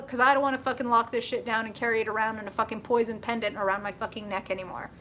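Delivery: ordinary talk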